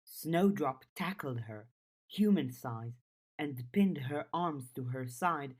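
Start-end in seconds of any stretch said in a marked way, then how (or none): none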